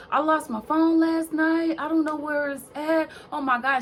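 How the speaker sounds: imitating the crying stylist, lightly done; mock freaking out. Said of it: "she's freaking out".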